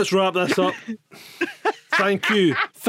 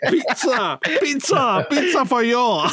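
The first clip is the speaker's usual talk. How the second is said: sing-song; 6 semitones higher, slower than usual. Pretend. Playful sing-song catchphrase.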